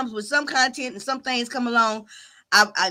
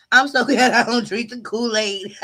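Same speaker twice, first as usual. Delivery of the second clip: line breaks into a real laugh mid-sentence; can't keep a straight face, chuckle through the words; 4 dB louder than usual. Real, not acted. Streamer laughing at her own Kool-Aid joke.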